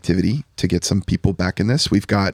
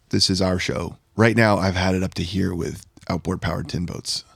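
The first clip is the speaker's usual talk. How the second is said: ordinary delivery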